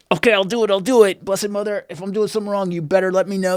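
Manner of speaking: acted-out pleading, pitched well up; exasperated surrender. Praying to Blessed Mother about the consecration, exasperated surrender.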